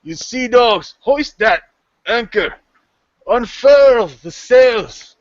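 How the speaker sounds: high, loud, drawn-out deck orders; shouted sailing command, words stretched; first mate yelling out. Acted. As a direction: bellow orders to the crew. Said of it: "the first mate yell out".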